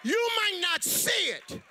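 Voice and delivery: loud, high shouting sermon; full force preaching. Acted. Preacher shouting to the congregation about Jesus. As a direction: whip up the congregation.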